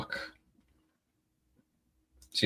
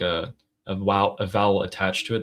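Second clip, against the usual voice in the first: ordinary delivery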